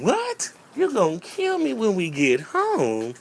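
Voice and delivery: a squeaky high "What?", then slow; stunned disbelief. Retold or imitated. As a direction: repeat the threat back in shock. Boy threatens to kill him.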